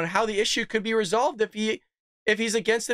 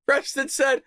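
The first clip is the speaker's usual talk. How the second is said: usual speaking voice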